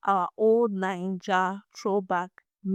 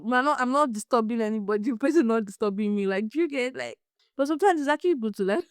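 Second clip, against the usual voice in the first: ordinary delivery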